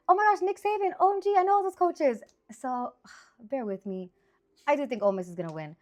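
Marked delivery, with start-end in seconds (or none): 0.1-2.2 imitating own gushing, much higher; fangirl excitement. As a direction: squeal it like a starstruck fan. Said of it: "I was like".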